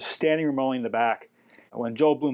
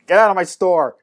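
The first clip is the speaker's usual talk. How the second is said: louder, higher mock order to leave; imitated short temper; 5 semitones higher, 8 dB louder than usual. Shop owner ordering the narrator out, mock angry shout. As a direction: throw them out, imitating a hot-tempered owner. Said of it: "he had a really short temper".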